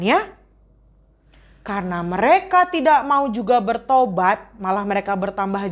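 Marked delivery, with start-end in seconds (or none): none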